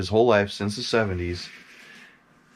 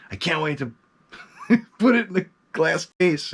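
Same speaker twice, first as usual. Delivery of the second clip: giddy laugh; 9 semitones higher than usual. Retold or imitated. Excited about mask arriving.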